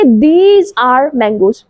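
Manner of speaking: very slow, word by word. Shown as a demonstration. Teacher modeling for pupils, very slow. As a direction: lay each word down slowly. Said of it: "slow बोलते हैं".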